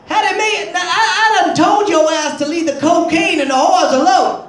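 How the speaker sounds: wife scolding him in a light [name] voice; Lawrence Fishburne as [name]. Retold or imitated. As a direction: bark it like an abusive husband, lightly voiced. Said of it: "sounding like Lawrence Fishburne when he played [name]".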